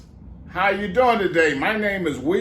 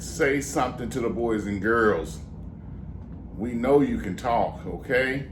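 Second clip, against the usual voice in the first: normal talking voice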